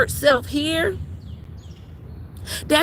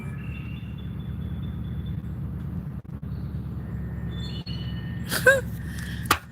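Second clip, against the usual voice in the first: long weary sigh; 12 semitones higher, 9 dB quieter than usual. Real, not acted. Long sigh after rant.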